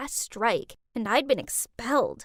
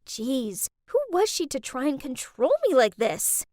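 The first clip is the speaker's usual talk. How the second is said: plain reading voice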